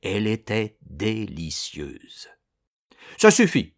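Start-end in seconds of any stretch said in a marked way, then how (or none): 3.2-3.7 commanding. Acted. Ordering the mill to stop.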